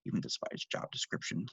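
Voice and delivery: annoyed mutter. Acted. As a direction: grumble under your breath.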